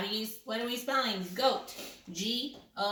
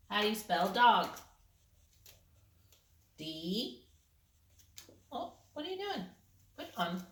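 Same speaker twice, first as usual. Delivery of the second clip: usual speaking voice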